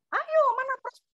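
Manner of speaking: sudden high-pitched "ayo mana pros-", prodding a friend; pushy nudge. Retold or imitated. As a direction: egg a lazy friend on, jumping way up. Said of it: "sesama ini ngomong".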